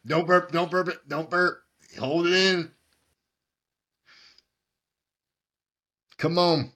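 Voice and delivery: high excited chanting; frat bro. Real, not acted. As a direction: hype up a friend like a coach. Cheering the chug.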